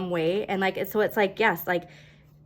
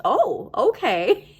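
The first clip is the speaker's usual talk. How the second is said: laughing oh okay; 7 semitones higher, 4 dB louder, much slower than usual. Pretend. Imagined pleasant surprise.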